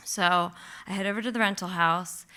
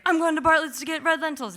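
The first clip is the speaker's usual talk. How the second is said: quick friend plan; 8 semitones higher, 4 dB louder, faster than usual. Retold or imitated. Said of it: "Dan's like".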